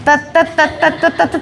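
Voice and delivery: rapid low stuttering; comic stuck-syllable stammer; flustered stammer. Acted. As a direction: stutter as if caught out. Uncle flustered by child's secret.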